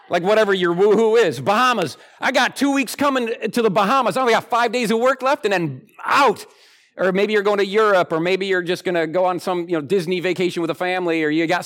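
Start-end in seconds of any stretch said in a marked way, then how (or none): 0.1-6.5 excited vacationer. Retold or imitated.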